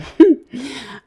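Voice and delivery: sudden high, loud burst of laughter; real laugh. Real, not acted. Laughing at herself while telling viewers about losing her passwords. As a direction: laugh at her own mistake.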